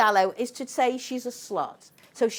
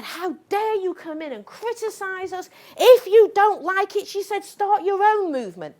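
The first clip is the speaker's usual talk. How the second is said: higher, louder mock outrage; imitating enraged young woman; 7 semitones higher, 5 dB louder than usual. To the audience, imitating the angry young organiser, mock outrage. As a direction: throw the young organiser's fury back, half-mocking. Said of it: "She is enraged at me".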